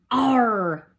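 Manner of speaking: a growled pirate arr. Shown as a demonstration. Teacher voicing spelling words like a pirate. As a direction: bark out the R like a pirate. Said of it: "we have the pirate R's in each one of our words".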